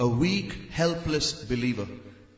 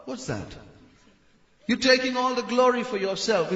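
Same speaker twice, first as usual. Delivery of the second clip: normal talking voice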